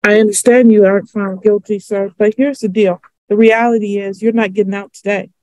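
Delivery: usual speaking voice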